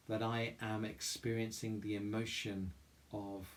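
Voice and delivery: soft, calm meditation voice with long pauses; guided meditation. Real, not acted. Chapel leader guiding listeners through mindful breathing, guided meditation. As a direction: guide them gently inward, leaving room to breathe.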